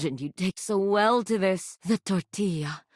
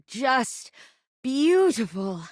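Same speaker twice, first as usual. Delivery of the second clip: rising higher on beautiful with delight; breathy rapture; 7 semitones higher, slower than usual. Acted. Seducing the player, higher delighted beautiful. Seductive game character line.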